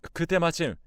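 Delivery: ordinary delivery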